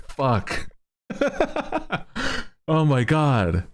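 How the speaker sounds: usual speaking voice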